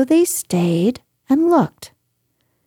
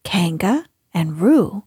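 plain reading voice

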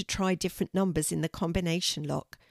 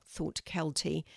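usual speaking voice

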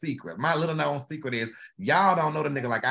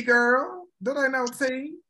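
high teasing; 9 semitones higher, slower than usual. Real, not acted. Teasing reply, pitched up.

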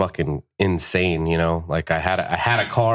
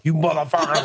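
high-pitched mock fury; retold angry curse, pitched up; retold shaking rage; 8 semitones higher than usual. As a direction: act out boiling over at him. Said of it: "like, shaking".

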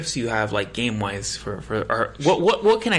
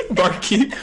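laughing; 10 semitones higher, 4 dB louder than usual. Real, not acted. Laughs at barkeep line.

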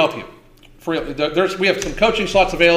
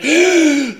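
mocking gasp for breath, pitched up; imitated gasp; 8 semitones higher, 4 dB louder than usual. Mocking a heavy woman's breathing, exaggerated gasp. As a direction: gulp air like she can't breathe.

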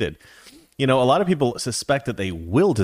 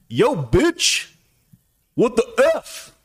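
high-pitched, hammed-up tough-guy impression; mock-menacing trash talk, pitched way up; Samuel L. Jackson; 12 semitones higher, slower than usual. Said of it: "don't all talk like Samuel L. Jackson".

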